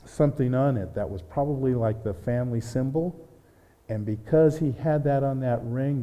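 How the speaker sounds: usual speaking voice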